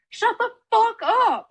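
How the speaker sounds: high, loud, slow snap; hammed-up angry shout; mock angry yell. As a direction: shout them down like a furious senior. Seniors snapping at freshmen.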